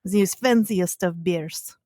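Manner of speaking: a slow, mock-posh unplaced accent, lightly done; mystery fancy accent. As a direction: savour the brand name in a vague put-on accent. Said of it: "I don't know what accent that is".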